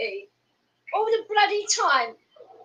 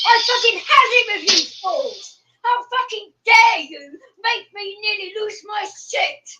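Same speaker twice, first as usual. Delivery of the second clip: angry; 4 semitones higher, 5 dB louder, slower than usual. Real, not acted. Venting fury at rivals on stream.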